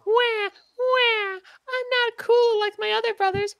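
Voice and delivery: mock-cry like a whiny little brother; high, slow bawling pony voice; whiny crybaby Keldeo. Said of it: "His entire character is that he's like".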